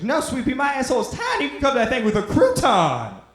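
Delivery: high, loud mock-grandma outburst; protesting grandma. Grandma's acted refusal to grandpa.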